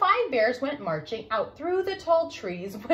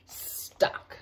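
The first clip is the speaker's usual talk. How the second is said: whisper; 5 dB quieter than usual. A narrator's reading. Narrator drops to a whisper.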